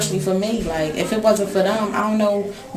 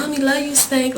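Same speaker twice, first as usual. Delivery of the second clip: put-on higher, cooing baby talk to her son; sweet talk to baby; 6 semitones higher than usual. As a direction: coo it like talking to a toddler. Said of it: "I be like".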